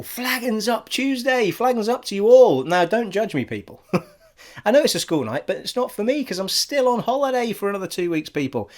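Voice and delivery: ordinary delivery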